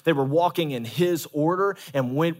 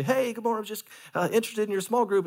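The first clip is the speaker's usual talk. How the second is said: mock polite caller; 6 semitones higher, faster than usual. Pastor acting a member calling a group leader, higher and polite. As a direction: sweetly ask on the phone. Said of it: "You might say".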